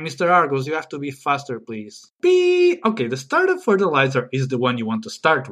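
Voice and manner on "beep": high, drawn-out "pee"; signal beep. Shown as a demonstration. Host demonstrates his sound before talking, high beep. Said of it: "So my sound is pee".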